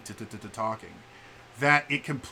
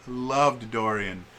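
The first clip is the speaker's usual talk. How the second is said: normal talking voice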